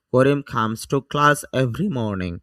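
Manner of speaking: slow model reading. Shown as a demonstration. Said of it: "teacher reads model sentence slowly".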